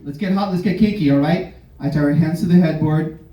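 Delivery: usual speaking voice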